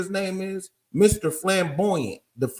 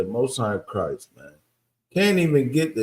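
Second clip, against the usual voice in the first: ordinary talk, nothing marked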